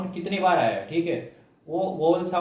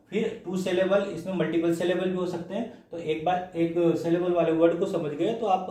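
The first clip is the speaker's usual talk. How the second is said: ordinary delivery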